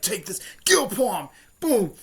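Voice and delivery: loud, high attack shout; battle cry. Retold or imitated. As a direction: call out the special move as it lands.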